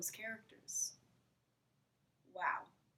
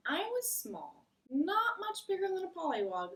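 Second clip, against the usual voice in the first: Frog starting his tale, pitched up; Frog's voice; 10 semitones higher, 3 dB louder than usual. Acted. Frog begins his story.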